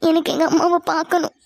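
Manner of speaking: put-on a child's tearful plea; tearful pleading. Crying girl begging to see her mother, tearful pleading. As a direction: plead through acted tears. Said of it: "கேப்பே இல்லாம அழுதுட்டு இருக்க".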